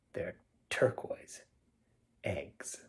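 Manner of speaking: fading whispered ending. Acted. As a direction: drop to a hush for the last words. Hushed ending of read poem.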